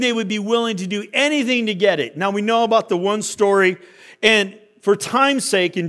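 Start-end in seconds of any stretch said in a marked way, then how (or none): none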